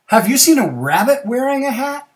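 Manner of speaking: high, loud storybook question; squirrel asking. Acted.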